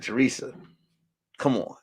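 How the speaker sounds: low, hushed brush-off; coy protest. Retold or imitated. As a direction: murmur it privately, in retold conversation. Coy private reply to Teresa.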